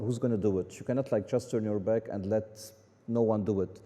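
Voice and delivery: ordinary talk, nothing marked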